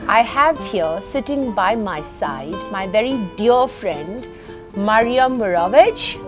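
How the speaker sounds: normal talking voice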